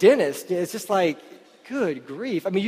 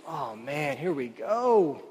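reluctant sigh; 4 semitones lower, 5 dB quieter, much slower than usual. Retold or imitated. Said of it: "do you just, like".

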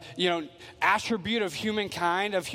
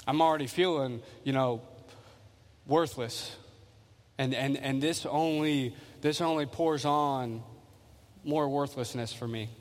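weary listener; 7 semitones lower, 4 dB quieter than usual. Retold or imitated. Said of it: "you're like".

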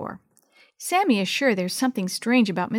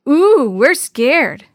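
loud, high, very drawn-out sarcasm; mock fear; 4 semitones higher, 7 dB louder, much slower than usual. A narrator's reading. Twins mock the girl who yelled, sarcastic fear. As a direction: pretend to be scared, tauntingly slow.

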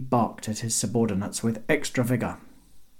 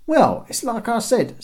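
high-pitched call from above; original guard; 12 semitones higher, 4 dB louder than usual. A narrator's reading. First guard answering the ultimatum, calling down. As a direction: explain it down to the boat. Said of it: "said the original voice".

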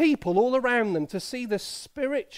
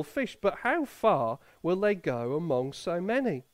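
lower, measured scripture reading; Bible reading; 5 semitones lower than usual. Acted. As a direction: read the Gospel passage solemnly to the church. Reads scripture to congregation.

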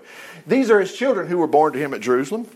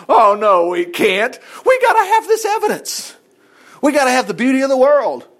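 loud, higher, rising to a shout; preaching at full force; 6 semitones higher, 5 dB louder than usual. Real, not acted. Preacher answering those who say it never happened, shouting. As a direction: rebut the doubters with full force. Preacher rebuts his own objection.

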